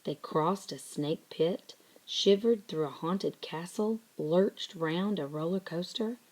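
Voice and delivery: usual speaking voice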